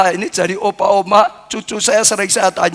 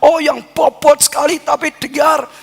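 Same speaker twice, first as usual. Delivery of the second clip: preaching build; 5 semitones higher than usual. Acted. Pastor assuring the church God prefers their worship, fervently. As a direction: drive home that God loves imperfect praise.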